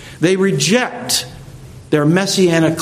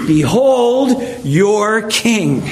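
slow, higher-pitched taunt; 5 semitones higher, much slower than usual. Acted. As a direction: draw it out to taunt the crowd.

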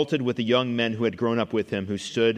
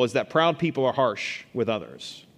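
ordinary talk

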